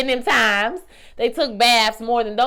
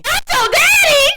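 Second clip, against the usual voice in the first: high, loud squealing disbelief; 10 dB louder than usual. Retold or imitated. Telling the character on screen, squealing in disbelief. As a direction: squeal "Not your daddy" much higher and louder. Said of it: "I said".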